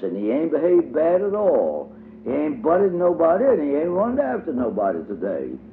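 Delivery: put-on high little girl's voice, proudly introducing herself; child voice for Dumps. A narrator's reading. Dumps tells Diddy her pretend name, high childlike voice. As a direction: pitch up for a small girl playing a grown lady.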